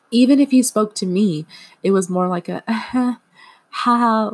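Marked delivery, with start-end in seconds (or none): 2.7-4.3 higher nervous uh-huh and little laughs; a frozen, awkward half-laughing reply. Retold or imitated. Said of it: "half smile, half laugh response".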